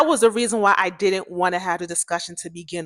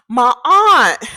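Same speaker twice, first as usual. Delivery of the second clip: loud, high mock cry of grief; imitated wail; 4 semitones higher, 10 dB louder than usual. Crying friend blurts out about aunt, imitated wail. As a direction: burst out wailing, imitating her.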